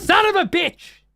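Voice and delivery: very high, loud, slow curse; furious outburst. Real, not acted. Streamer cursing as the invader throws off his intro, furious shout. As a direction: explode in real rage.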